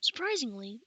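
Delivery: ordinary delivery, nothing marked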